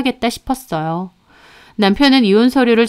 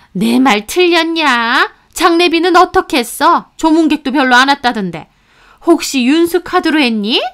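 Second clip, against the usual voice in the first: put-on higher, sharp-tongued older woman scolding; scolding mother-in-law; 6 semitones higher, 4 dB louder than usual. Mother-in-law lecturing her daughter-in-law at the funeral, higher and scornful. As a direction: berate her like a contemptuous mother-in-law, pitched up.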